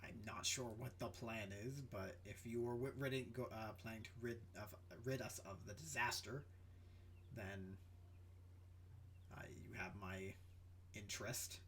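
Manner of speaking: low, quiet captain with halting pauses; softly spoken, low-voiced negotiator; hesitant captain. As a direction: speak low and measured, pausing between phrases. Game master plays a non-player character.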